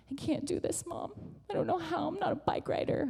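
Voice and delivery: put-on small, high, soft child voice; imitating tearful frustration. Frustrated six-year-old tells Mom she can't, tearful. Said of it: "she's so frustrated, like, on the verge of tears".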